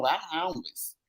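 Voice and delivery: ordinary talk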